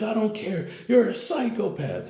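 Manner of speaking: high-pitched mock outrage; acted frantic defiance. Captive argues back in panic.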